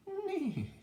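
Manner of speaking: very high, loud whinny; whinny like a horse; squealed storybook neigh. A narrator's reading. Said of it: "said a horse".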